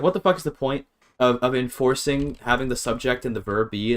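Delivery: ordinary talk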